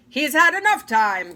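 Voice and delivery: the king snaps back loudly; cried. A narrator's reading. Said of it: "cried the king".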